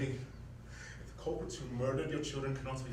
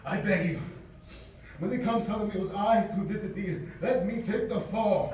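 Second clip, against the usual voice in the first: desperate plea; 6 semitones higher, 5 dB louder than usual. Acted. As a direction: beg her on your knees. Servant pleading loudly.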